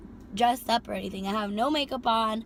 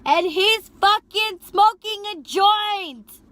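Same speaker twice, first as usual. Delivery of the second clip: higher, louder and slow; outraged telling; 10 semitones higher, 10 dB louder, much slower than usual. Real, not acted. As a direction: spit it out in disbelief. Seeing passenger smoking.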